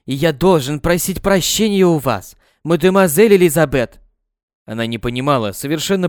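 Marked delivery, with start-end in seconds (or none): 0.0-4.0 громче, торопливо и с напором; перекрикивает мать, беря вину на себя; повышая голос. Acted. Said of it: "Заговорил быстро, повышая голос". (Жак кается перед Лизой, громче)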